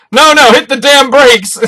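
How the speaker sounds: loud slow panicked shout, high. Retold or imitated. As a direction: yell to stop him. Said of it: "voicing a frantic character".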